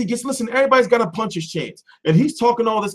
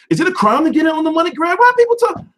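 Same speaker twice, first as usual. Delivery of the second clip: raised, fast mock-indignant protest; higher, louder, rushing defensive rant; 9 semitones higher, 4 dB louder, faster than usual. Streamer protesting to chat, mock indignant.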